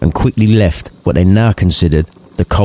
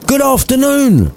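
high, hammed-up angry dismissal; pissed off. Scrooge angrily dismissing his nephew, much higher. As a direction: snap it out to end the talk. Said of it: "much more pissed off coming from Scrooge".

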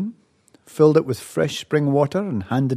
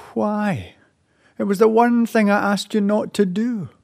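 upset mother; 8 semitones higher than usual. Acted. Mother scolds her son.

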